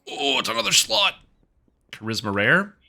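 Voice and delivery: real gasp, then high-pitched delight; excited surprise. Real, not acted. Gamer finds another slot on an item, excited surprise.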